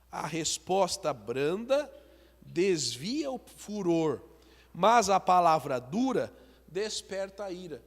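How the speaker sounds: slow preacher's Bible reading; solemn scripture reading. Acted. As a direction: read it out to the congregation, slowly. Reads Proverbs fifteen one.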